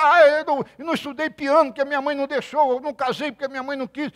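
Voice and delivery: mock whiny complaint. Mocking the woman's complaint.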